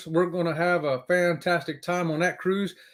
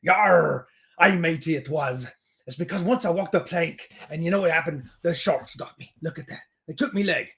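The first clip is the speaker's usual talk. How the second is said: playful mock pirate voice; pirate talk. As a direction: spin a silly pirate yarn. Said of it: "my pirate talk was perfect".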